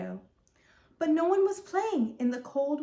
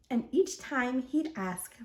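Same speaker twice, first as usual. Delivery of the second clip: ordinary delivery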